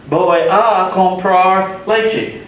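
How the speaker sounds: slow, carefully articulated Spanish for learners; slow Spanish model. Shown as a demonstration. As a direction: model it slowly for the class. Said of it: "I might say".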